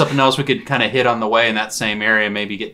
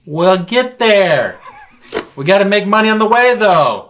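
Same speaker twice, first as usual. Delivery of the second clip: yelling back; 10 semitones higher, 7 dB louder than usual. Acted. Calling back across the room.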